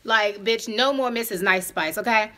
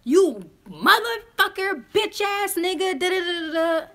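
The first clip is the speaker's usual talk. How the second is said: mock cussing out; 8 semitones higher than usual. Mocking how the date heard her as cussing him out, hammed-up. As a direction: pretend to cuss him out. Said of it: "you would've thought that I was like".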